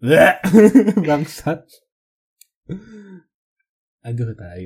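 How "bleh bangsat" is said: grossed out. Real, not acted. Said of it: "Geli banget".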